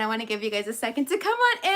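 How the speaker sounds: ordinary talk, nothing marked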